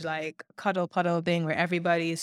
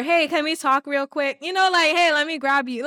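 upbeat friendly ask; 7 semitones higher, 5 dB louder than usual. Retold or imitated.

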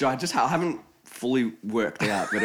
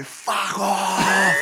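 higher, louder mock yell; retold shout; 7 semitones higher, 4 dB louder than usual. Girl yelling at a drunk supporter, loud mock shout. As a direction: snap it loud in his face. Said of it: "Like, loud in his face".

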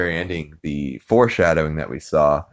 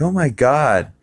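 higher, grossed-out gasp; disgusted teen girl; 7 semitones higher than usual. Retold or imitated. Meg catching her parents kissing, high and disgusted. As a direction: react like an embarrassed teen. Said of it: "Meg's like".

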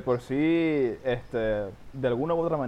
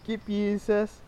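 teasing drawl; 11 semitones higher, much slower than usual. Acted. Drawn-out teasing question.

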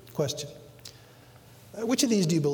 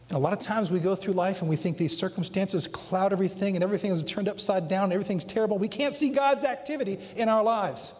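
ordinary delivery

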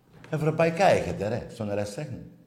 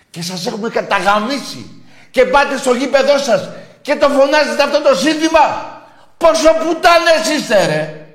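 screamed much higher and louder, mocking rival fans; furious shout; 14 semitones higher, 12 dB louder than usual. Radio host screaming at Panathinaikos fans, furious shout. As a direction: bellow the insults at the terrace, full fury.

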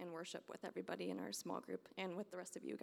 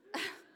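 relieved laugh; 13 semitones higher, 4 dB louder than usual. Real, not acted. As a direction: burst of high happy laughter. Relief after sharing on stage.

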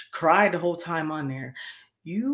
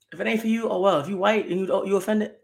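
ordinary talk, nothing marked

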